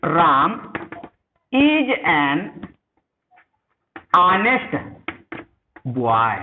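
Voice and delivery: slow, spaced word by word while writing; "Ram is an honest boy" with long pauses between words; slow dictation. Shown as a demonstration. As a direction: read it out as you write it on the board. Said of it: "अब देखिए यहां पर".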